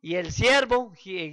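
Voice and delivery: ordinary talk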